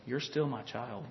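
very soft, low murmur; still small voice. Acted. God reassures a lonely believer, still small voice. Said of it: "that still small voice saying".

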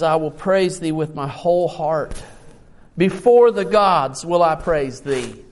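loud, declamatory praise from the pulpit; strong, measured Bible reading; preached psalm reading. Real, not acted. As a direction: proclaim the psalm in full voice. Reads psalm loudly to congregation.

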